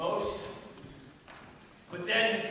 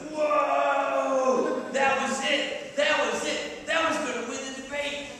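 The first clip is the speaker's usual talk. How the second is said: louder, higher, sure of victory; excited crowd; 5 semitones higher, 5 dB louder, slower than usual. Retold or imitated. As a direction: cheer like the winner is decided.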